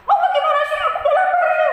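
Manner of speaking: very high, loud squealing tantrum; acted kid yelling at the top of the range; angry child scolding. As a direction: throw a shrill hungry tantrum at mama. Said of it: "marah-marah, mamahnya malah dimarahi".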